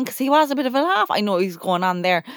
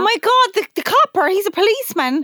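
high, loud oh my God the copper; excited recognition; 9 semitones higher, 5 dB louder than usual. Real, not acted. Friend recognises the policeman from the chat, excited squeal. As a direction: squeal it out as it clicks.

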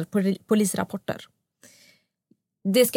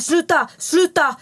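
high, slow cry to stop; retold scream; 6 semitones higher, 4 dB louder, slower than usual. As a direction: imitate a frightened boyfriend's shout. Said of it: "Max ligger ovanför mig och skriker".

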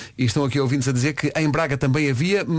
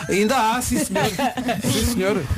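laughing mock insistence; 7 semitones higher than usual. Mimicking the insistent listener.

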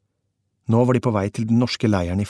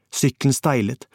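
ordinary delivery, nothing marked

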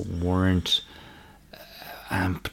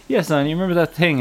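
high, quick eager prompting; prompting host; 8 semitones higher, 4 dB louder, faster than usual. Retold or imitated. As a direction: nudge a guest to remember, brightly. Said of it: "we were like".

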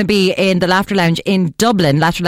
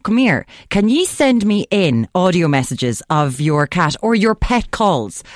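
ordinary delivery

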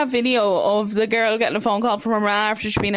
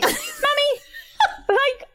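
pleading daughter; 14 semitones higher, slower than usual. Retold or imitated. Girl pleading with scolding mother.

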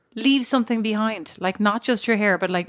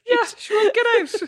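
high, laughing mock complaint; 12 semitones higher than usual. Joining the taxi driver bit.

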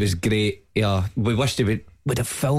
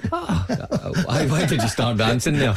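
laughing; 3 semitones higher, slower than usual. Real, not acted. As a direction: tease him while cracking up. Mocking co-host's dancing.

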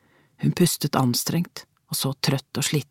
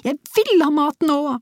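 put-on much higher, stubborn child's whine; child whining with more defiance; defiant whine; 12 semitones higher, 3 dB louder than usual. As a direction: dig in and whine harder. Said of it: "med enda mer trass".

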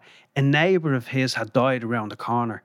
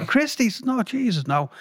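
a high, startled cry of disbelief; shocked disbelief; 10 semitones higher, slower than usual. Retold or imitated. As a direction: gasp it out as if hearing of a death. Shock at the news of a death.

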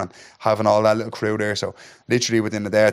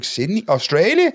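high, rising, each word questioned; incredulous echo; 9 semitones higher than usual. Retold or imitated. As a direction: echo the place names in disbelief. Repeating the news in shock.

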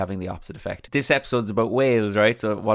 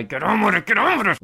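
higher, louder mock nagging voice; much higher put-on nagging voice; self-urging; 11 semitones higher, 4 dB louder than usual. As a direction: hurry yourself along in a silly high voice. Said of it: "come on, [name]".

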